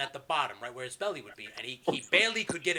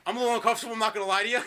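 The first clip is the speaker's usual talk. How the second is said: sheepish admission; 6 semitones higher, 5 dB louder, much faster than usual. Retold or imitated. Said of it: "he goes".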